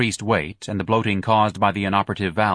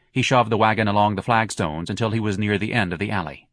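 ordinary delivery

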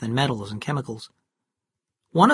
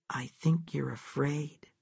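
soft, slow, distant replay; echoing memory; 8 dB quieter, slower than usual. A narrator's reading. Cal's words echoing in Kate's head, soft and slow. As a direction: hear it as a haunting echo in her head. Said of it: "Cal's words kept repeating ... in her head".

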